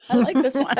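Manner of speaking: high, loud, laughing approval; laughing delight. Acted. Loathsome hag delights in Gawain, laughing high and loud. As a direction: cackle with glee at the knight.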